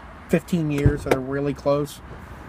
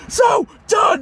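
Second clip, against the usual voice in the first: high-pitched, strained impression of an angry parent; mock shriek of outrage; furious mock scolding; 14 semitones higher, 4 dB louder than usual. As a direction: imitate a parent blowing up, voice pitched way up.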